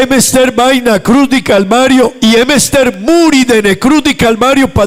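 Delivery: high, ringing preacher's build; preaching. Acted. Pastor preaching to the congregation about salvation, fervent. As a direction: preach it out to the church.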